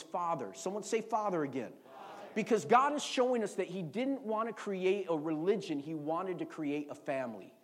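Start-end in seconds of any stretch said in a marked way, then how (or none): none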